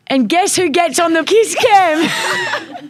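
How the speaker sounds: delighted big announcement; gleeful reveal. Real, not acted. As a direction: tease it out slowly, laughing. Host's big reveal of the story.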